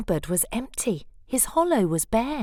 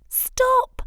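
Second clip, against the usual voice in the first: playful high pop; 5 dB louder than usual. A narrator's reading. Narrator sound effect on cone stop.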